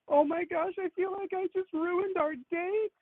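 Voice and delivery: very high, drawn-out mock dismay; fretting date. Date watching angry men search, mock dismay about the date. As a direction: mimic her fretting in a slow high voice. Voicing date's misplaced worry.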